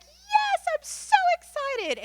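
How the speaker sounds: very high, loud, drawn-out squeal of joy; wife cheering from the sidelines, squealing with excitement; excited squeal. Retold or imitated. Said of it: "She's like".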